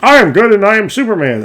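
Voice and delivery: high, loud mock-heroic boast; hammed-up hero. DM mocking a boring hero character, mock-heroic proclamation. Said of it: "you don't wanna be like".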